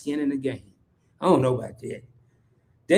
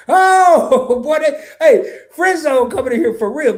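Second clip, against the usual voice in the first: high, loud, laughing through a viewer's comment; laughing, excited reading; 10 semitones higher, 8 dB louder, slower than usual. Retold or imitated. Host reading a viewer's teasing comment, laughing loudly. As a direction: crack up while reading the comment out. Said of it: "Friend Zone said".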